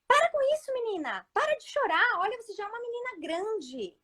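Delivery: high-pitched mock scolding of a crying girl; exasperated scolding mother. Mother scolding her daughter thrashing on the floor. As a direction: snap at the child to stop crying. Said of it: "eu era aquela mãe".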